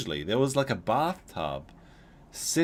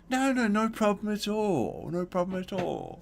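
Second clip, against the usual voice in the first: put-on flamboyant old neighbor; 9 semitones higher than usual. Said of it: "the way the guy said it was so flamboyant".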